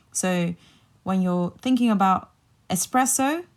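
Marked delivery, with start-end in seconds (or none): 2.7-3.5 espresso with an S, no X, rising at the end; correct espresso. Shown as a demonstration. Teacher asks learners to think about it, espresso with S, rising. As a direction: say espresso as ess-PRESS-o, pitched up. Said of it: "take out the X".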